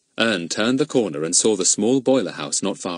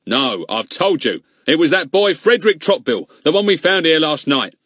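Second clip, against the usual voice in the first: high, louder cross retort; 7 semitones higher, 6 dB louder than usual. Acted. Goon crossly answering his companion. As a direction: snap it back irritably. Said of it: "said Goon crossly".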